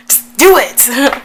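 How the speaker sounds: loud, high, drawn-out plea; mock urging. Vlogger urging her past self to kiss, exasperated. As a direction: stretch it out in playful exasperation.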